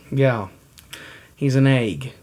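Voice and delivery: ordinary delivery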